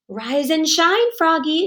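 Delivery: lightly voiced cheery dad; cheery father. Father waking Froggy, cheery sing-song. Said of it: "said his father".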